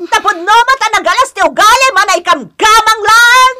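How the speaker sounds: shouting high and loud, fast and scolding; furious shout. Acted. Mother-in-law shouts at her daughter-in-law, furious. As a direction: yell it out at her, pitch way up.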